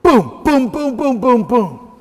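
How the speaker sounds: loud, punchy shouted booms, each one a shot; loud imitated gunfire, boom after boom; mock gunshots. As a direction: fire off the booms like a pistol going off. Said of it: "Mary sets up and goes".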